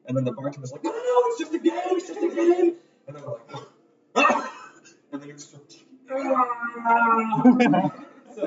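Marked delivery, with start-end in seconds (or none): 0.8-2.8 high loud shouting to calm a crowd; frantic shout. Acted. Bartender calming armed crowd. 4.1-4.5 high loud ah; startled cry. Acted. Crowd cries out. 6.1-7.9 high loud ah; startled cry. Acted. Crowd cries out.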